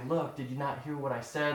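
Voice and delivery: a soft, hushed scolding, half joking; mock whisper in the ear. Teasing a follower who didn't listen, a mock whisper. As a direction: lean in close and scold them gently. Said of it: "whisper in your ear and say".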